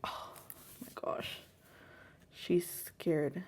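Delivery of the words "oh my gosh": soft, whispered retold sigh of relief; breathy relief. Mother recalling finding her daughter, breathy retold relief. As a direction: let it out as a quiet exhale.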